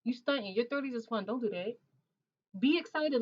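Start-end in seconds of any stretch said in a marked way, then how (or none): 2.6-3.2 cheering. Real, not acted. Cheering on a viewer.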